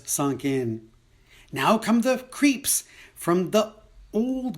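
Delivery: poem read as a mock parade announcement; comic contest announcer. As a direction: announce each entrant with relish.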